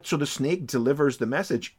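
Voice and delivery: normal talking voice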